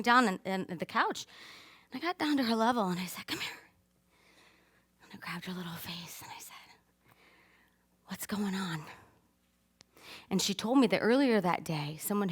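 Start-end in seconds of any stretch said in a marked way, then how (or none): 3.3-3.6 soft, hushed coaxing; gentle, low-volume invitation; tender whisper. Retold or imitated. Retelling tender moment with daughter. 8.1-8.8 soft, hushed question; hushed motherly concern; tender whisper. Retold or imitated. Mother gently asking upset daughter.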